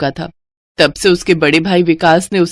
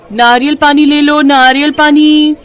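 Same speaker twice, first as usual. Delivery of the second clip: street vendor call; 6 semitones higher, 4 dB louder than usual. Acted. Kajal hawks coconut water to passers-by, loud vendor cry. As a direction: call it out over the market, high and loud.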